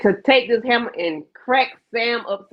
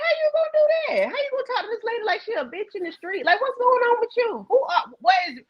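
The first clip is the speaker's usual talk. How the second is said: much higher, fast, incredulous; acting out Priscilla, high and quick; Priscilla's outrage; 6 semitones higher than usual. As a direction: fire off the questions high and fast. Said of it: "put on Priscilla's shoes".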